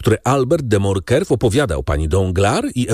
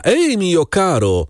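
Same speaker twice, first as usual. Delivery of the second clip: high, drawn-out hail to the coachman; narrated high call; 9 semitones higher, slower than usual. A narrator's reading. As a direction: call it out slowly, pitched up without real shouting. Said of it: "krzyknął do pocztyliona".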